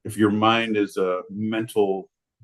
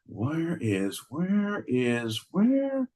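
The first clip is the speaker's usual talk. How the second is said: searching aloud, rising higher each time; mocking co-host impression; 8 semitones higher, 5 dB quieter, much slower than usual. Comic teasing John as he searches his notes, mocking impression. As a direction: imitate John hunting for the definition, drawn-out and climbing. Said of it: "John's like".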